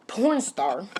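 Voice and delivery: "porn star" stretched very slowly with a rising curl; slow, sneering emphasis on "porn star"; disdainful drawl. Real, not acted. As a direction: let the words hang out in contempt. Contemptuous label for dinner guest.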